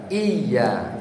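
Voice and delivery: "Iya" with a held, doubled y, falling; pressed tasydid. Shown as a demonstration. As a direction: lean on the y of "Iya": iy-ya.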